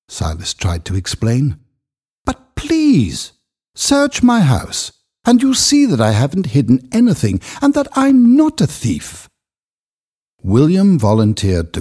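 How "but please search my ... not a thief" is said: much higher, pleading voice; desperate pleading. Acted. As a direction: beg them to search the house. Accused man begs to be believed.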